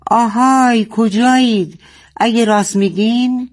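storybook father yelling out in anger; angry shout. A narrator's reading. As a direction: holler a challenge at the enemy. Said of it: "خشمگین فریاد زد".

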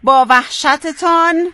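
drawn-out phrase for repeating; feed it slowly, syllables stretched; slow dictation. Shown as a demonstration. Host giving the contestant the next words, slow dictation.

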